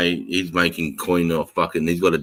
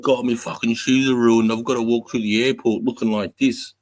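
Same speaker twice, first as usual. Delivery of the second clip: higher whining mock complaint; mocking whiny influencer; 6 semitones higher than usual. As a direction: whine about ruined shoes. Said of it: "he's like".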